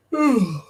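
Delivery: long, heavy sigh of tiredness; exhausted sigh before a curse; weary sigh. Real, not acted. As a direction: let out a tired, fed-up sigh. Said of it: "Fuck my life".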